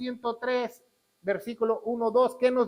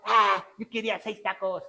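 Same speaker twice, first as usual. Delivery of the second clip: hammed-up ungrateful grumble, opening on a big "Ah"; mock complaint. Preacher mimics an ungrateful believer to the congregation, mock complaint. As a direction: imitate someone let down by a small helping.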